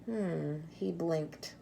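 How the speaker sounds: ordinary delivery, nothing marked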